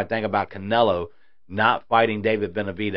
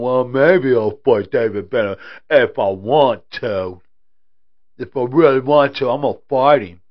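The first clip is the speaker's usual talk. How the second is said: mocking Canelo; 2 semitones higher, 7 dB louder than usual. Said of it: "you wouldn't have Canelo going".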